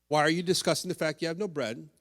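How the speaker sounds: rattled off fast; preacher recapping for the congregation, fast; quick recap. Shown as a demonstration. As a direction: run through it briskly as a summary. Quick recap of Jesus' questions.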